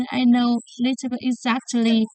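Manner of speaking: ordinary talk, nothing marked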